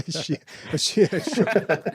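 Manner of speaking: very slow exaggerated chien chaud; mock French. Repeating the joke word, a slow mock French.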